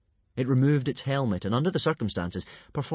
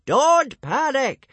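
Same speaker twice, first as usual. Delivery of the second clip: high, loud, drawn-out shout; slow yelling; 9 dB louder, much slower than usual. A narrator's reading. Old Wrinkly yelling over the panicking hooligans, slow shout. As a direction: yell each word slowly at the crowd. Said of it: "he started yelling".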